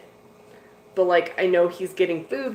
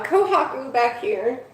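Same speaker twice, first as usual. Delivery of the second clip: ordinary talk, nothing marked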